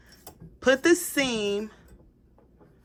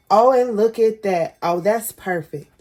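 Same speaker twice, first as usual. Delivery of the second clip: normal talking voice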